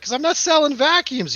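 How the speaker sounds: high, loud indignant outburst; mock outrage down the phone; retold angry retort. Acted quarrel on the phone.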